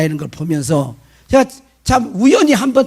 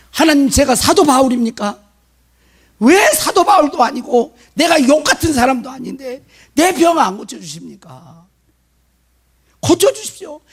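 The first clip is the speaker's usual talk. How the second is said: retold high-pitched protest to God; complaining prayer; 6 semitones higher than usual. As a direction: plead and grumble upward, acting out his prayer. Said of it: "원망 불평을 쏟아놓고 있더래요". (하나님께 원망하듯 높게 따지는 기도 재연)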